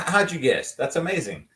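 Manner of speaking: usual speaking voice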